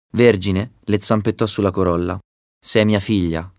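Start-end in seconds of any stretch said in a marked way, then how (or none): none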